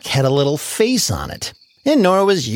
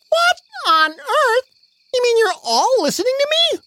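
much higher, astonished; amazed Nora. Acted. Nora astonished that everything listens. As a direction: shriek out the disbelief.

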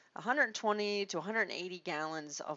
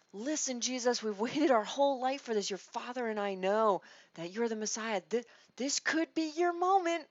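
higher, louder, eager plea; eager Mary; 5 semitones higher, 5 dB louder than usual. Retold or imitated. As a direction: urge your son, excited and hopeful. Mary voiced urging Jesus.

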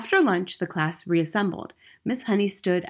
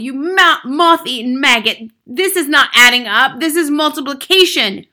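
high, loud raging rant; furious, bullying headmistress; yelled tirade; 7 semitones higher, 11 dB louder than usual. A narrator's reading. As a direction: berate the boy mercilessly. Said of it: "yelled the Trunchbull".

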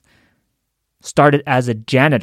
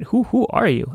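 higher, softer, baffled retake of the question; incredulous CEO; 7 semitones higher, 3 dB quieter than usual. Retold or imitated. CEO baffled by a janitor on the line, high disbelief. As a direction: lift it up in disbelief, almost breathless. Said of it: "the C E O is like".

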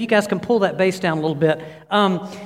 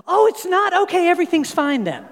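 put-on much higher, breezy girlish relief; cheery daughter; 11 semitones higher than usual. Dreamed daughter learning it wasn't her fiancé, suddenly cheerful. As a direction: flip instantly from tears to carefree.

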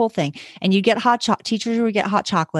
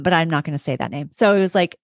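ordinary talk, nothing marked

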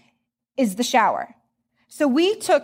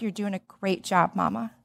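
softer and lower, gently consoling; tender reassurance; 5 semitones lower, 7 dB quieter than usual. Retold or imitated. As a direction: hand on her hand, quietly encouraging. EMS worker comforting young mom.

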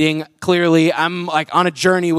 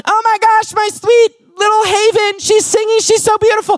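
high-pitched, hammed-up doting squeal; gushing parent; 12 semitones higher, 4 dB louder than usual. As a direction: gush like a parent filming their kid on stage. Imitates gushing parent filming child.

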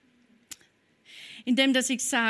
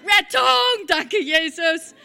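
laughing relief; 5 semitones higher, 7 dB louder, slower than usual. Retold or imitated. Relief when help arrives.

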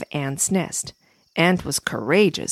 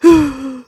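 sobbing gasp; 7 semitones higher, 7 dB louder than usual. Acted. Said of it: "Butterfly sobbed".

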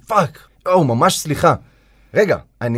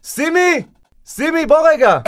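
much higher call of סימי across the room; 14 semitones higher, 4 dB louder, slower than usual. Acted. Host calling his expert over, raised voice.

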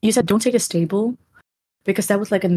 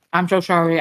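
ordinary talk

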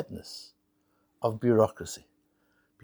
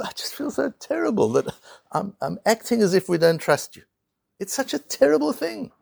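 acted distress, pitch lifting high; mock tearful; 6 semitones higher than usual. Clerk to customer while signing documents, mock tearful. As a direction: plead as if upset, without real sobs. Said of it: "he's sobbing away and he's crying".

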